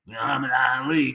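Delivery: mock the promoter's overblown praise in a low mumble; imitating Bob Arum. Mocking promoter Bob Arum praising Lomachenko, low mock voice. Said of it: "Bob Arum was like".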